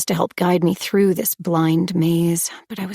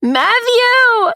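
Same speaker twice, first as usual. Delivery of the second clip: a desperate, raw cry into the empty woods; guttural scream; 6 dB louder than usual. A narrator's reading. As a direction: scream the name out, high and loud. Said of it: "let out a guttural scream".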